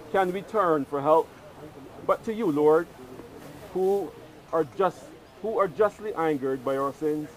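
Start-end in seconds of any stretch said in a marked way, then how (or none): none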